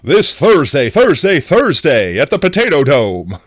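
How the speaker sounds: punchy arena-announcer hype; hammed-up announcer, drawing out the last words; mock event announcer. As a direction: announce it like a big show. Mock event-announcer stream opening.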